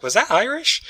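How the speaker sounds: high-pitched incredulous question; mock bewilderment, pitched well up; puzzled, as if doubting your ears; baffled reaction. Quotes own puzzled reaction to accent.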